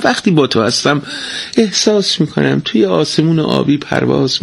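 dreamy, doting; tender husband. Acted.

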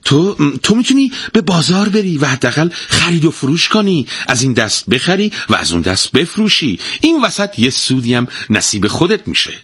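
high, eager pitch at the start; a wife eagerly proposing a plan; excited. A narrator's reading. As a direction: sell the idea with enthusiasm. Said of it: "زن با هیجان گفت".

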